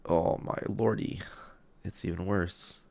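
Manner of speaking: ordinary talk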